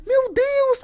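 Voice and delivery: high astonished cry; amazed exclamation. Retold or imitated. Pediatrician amazed seeing the toddler jump. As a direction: gasp out in amazement. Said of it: "pum, pulou ela".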